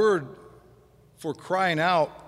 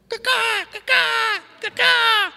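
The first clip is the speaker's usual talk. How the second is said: much higher, harsh crow cawing, getting louder; crow caw imitation; 5 dB louder, slower than usual. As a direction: caw like an irritating crow at dawn. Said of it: "have you ever heard a, a, a crow?".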